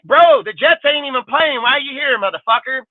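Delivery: high mocking heckle at a stranger; taunting heckle. Heckling rival fans in the stadium, high and taunting.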